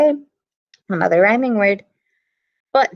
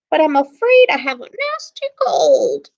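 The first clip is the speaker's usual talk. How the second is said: scratchy voice; 7 semitones higher than usual. Acted. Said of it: "came a scratchy voice".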